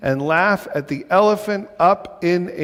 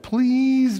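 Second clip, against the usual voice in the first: higher, pleading bird voice; begging; 9 semitones higher than usual. A narrator's reading. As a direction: beg sweetly. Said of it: "begged the bird".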